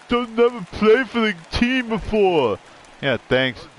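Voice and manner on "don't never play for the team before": much higher, hammed-up whiny scold; mocking nitpicker. An imagined fan scolding another fan's jersey, mocking. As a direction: parody a fussy fan correcting a jersey. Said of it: "I don't go".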